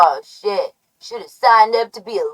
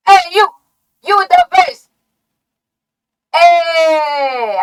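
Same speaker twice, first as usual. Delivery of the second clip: calling out; 6 semitones higher, slower than usual. Acted. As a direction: yell across the room to grab them. Calling out someone.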